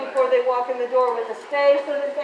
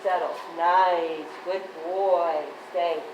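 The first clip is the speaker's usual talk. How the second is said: talking to a dog; 8 semitones lower, much slower than usual. Real, not acted. Trainer calming the excited dog.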